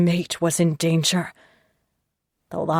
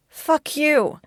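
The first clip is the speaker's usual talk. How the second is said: defiant curse; 10 semitones higher, 3 dB louder than usual. Acted. Kidnapped woman defies captor.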